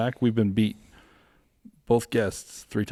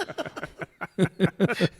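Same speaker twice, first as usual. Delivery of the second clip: laugh; 10 semitones higher than usual. Real, not acted. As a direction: giggle on after your own joke. Laughs after mock doctor quote.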